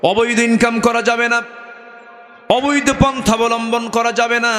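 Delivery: high-pitched sermon delivery; preaching. Acted. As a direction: preach it high and insistent to the congregation. Preaches in raised chant.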